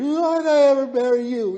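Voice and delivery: hammed-up wifely lament, loud and high; mock wail. Wife lamenting her marriage to husband, exaggerated wail.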